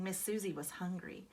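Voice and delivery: plain reading voice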